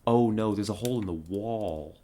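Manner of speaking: loud dismayed oh no. Real, not acted. Discovers another hole.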